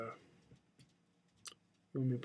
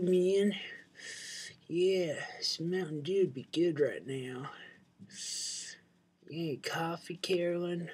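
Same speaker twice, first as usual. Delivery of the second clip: higher, louder folksy uncle voice, pretending to smoke; uncle impersonation; 6 semitones higher, 3 dB louder than usual. The uncle asking for Mountain Dew and coffee, in a higher, louder folksy voice. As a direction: play the uncle calling out to Carolyn and Bill. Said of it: "I do an impersonation of my uncle".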